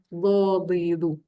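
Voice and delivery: "Dadidu" very slow, thick d off the molars; heavy, drawn-out Arabic dad; slightly lisped dad. Shown as a demonstration. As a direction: thicken "Dadidu" with the side of the tongue. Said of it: "sifat dia ada sedikit pelat".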